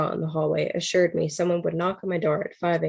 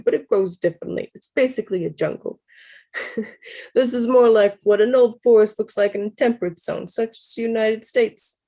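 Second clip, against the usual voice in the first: amused, higher Brooklyn voice cracking into laughs; Brooklyn accent; 4 semitones higher, 4 dB louder than usual. Acted. Hiker marvels at the forest in a giggly Brooklyn accent. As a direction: lecture about old trees in a Brooklyn accent. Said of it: "How's, how's my Brooklyn accent, guys?".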